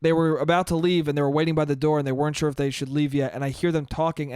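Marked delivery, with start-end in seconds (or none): none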